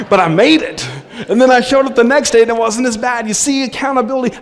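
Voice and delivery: a real laugh carrying into the telling; laughing through words. Real, not acted. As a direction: speak while the laugh lingers. Laughing while telling of recovery.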